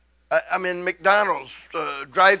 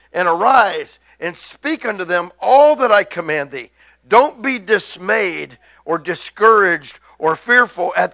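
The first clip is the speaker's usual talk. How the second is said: preaching scripture; 6 dB louder than usual. Acted. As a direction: proclaim each line like a pulpit charge. Reads scripture with force.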